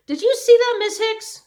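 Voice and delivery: put-on boy shouting to his teacher about privilege; shouted. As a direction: yell it in outrage. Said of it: "Homer Macaulay shouted".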